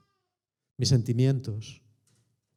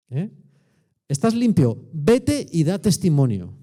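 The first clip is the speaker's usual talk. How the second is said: usual speaking voice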